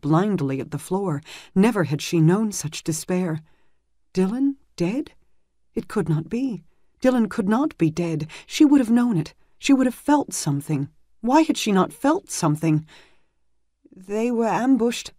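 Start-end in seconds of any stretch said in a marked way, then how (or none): 4.1-12.8 rising despair. Acted. Healer's despairing inner thoughts. 13.9-15.1 put-on young squire voice. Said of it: "Marcus said".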